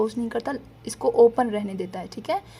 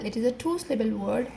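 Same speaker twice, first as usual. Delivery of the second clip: ordinary talk, nothing marked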